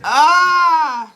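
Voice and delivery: imitating a contraction moan; mock labor moan. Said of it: "just be".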